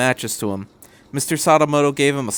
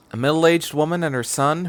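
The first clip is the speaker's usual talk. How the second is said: usual speaking voice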